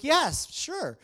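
high, loud, bright eager acceptance; eager yes. Retold or imitated. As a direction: jump at the offer happily. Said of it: "I was like".

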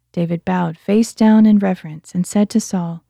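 ordinary delivery

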